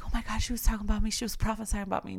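very fast, tumbling words; quick, lightly mocking listener voice; rushed mock gasp. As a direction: imitate someone flustered and paranoid. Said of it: "y'all be like".

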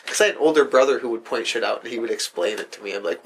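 ordinary talk, nothing marked

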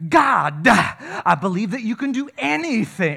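mocking over-the-top praying; mock showy prayer voice. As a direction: overdo the churchy prayer. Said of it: "When they start praying".

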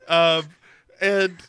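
ordinary talk, nothing marked

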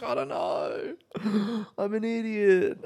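high breathy giggle through the words; laughing self-mockery. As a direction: laugh at yourself. Self-mocking reply, laughing.

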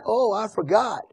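mock realization, falling away; sarcastic. Paul to those putting up with him, sarcastic. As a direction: feign forgetting, then drop it dryly.

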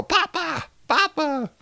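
high voice of a son running home to his father; prodigal son calling. Acted. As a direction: cry out like a lost son coming home.

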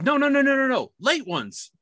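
imitating insistent boss. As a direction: cut him off, eager to correct.

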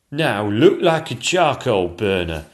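loud, slow order; a foreman calling out instructions; cried command. Acted. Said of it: "cried the chief royal painter".

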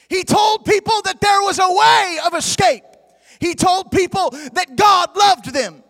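loud, sung-out gospel preaching; full-force preaching. Acted. Preacher to congregation, singing out full-force preaching. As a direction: preach it over the music, big and loud.

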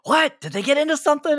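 high-pitched mimicked yell; mock yelling. Guest acting out the host yelling into dead silence, mock yell. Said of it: "You, you just yelling".

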